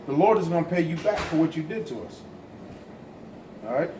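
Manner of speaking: ordinary talk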